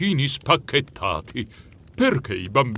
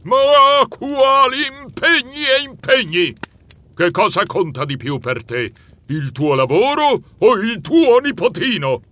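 high, loud booming scold; thundering; 10 semitones higher, 5 dB louder than usual. Acted. Santa thundering at the busy mouse, loud. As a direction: shake the windows with it. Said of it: "Lui tuonò facendo tremare i vetri".

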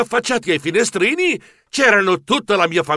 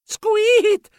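very high little squeal; mouse squeak. A narrator's reading. Mouse hero hugging family and friends, high squeak. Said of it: "e dissi".

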